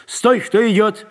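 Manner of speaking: high, slow barked shout; barked challenge. A narrator's reading. Sentry challenges newcomers from outside, barking. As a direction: bark it out like a sentry. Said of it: "рявкнули снаружи".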